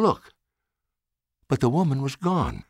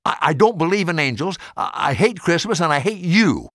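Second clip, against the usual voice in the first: fast, higher, pushing back tears of anger; angry tearful outburst; 6 semitones higher, 3 dB louder than usual. A narrator's reading. Maggie lashing out at the old man, angry and tearful. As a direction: blurt it out, hurt and furious. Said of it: "Maggie's eyes filled with angry tears".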